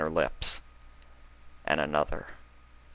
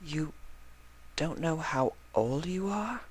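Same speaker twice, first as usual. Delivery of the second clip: higher, softer voice for the sweet mare; a soft, higher Octavia, stunned; 10 semitones higher, 9 dB quieter than usual. Acted. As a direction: voice Octavia gently shocked.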